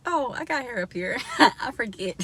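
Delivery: playful surprise. Retold or imitated. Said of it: "and I'll be like".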